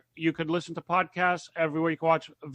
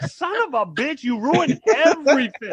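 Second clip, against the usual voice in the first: mock outrage; 9 semitones higher, 4 dB louder than usual. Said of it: "And I'm like".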